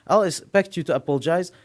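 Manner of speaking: ordinary delivery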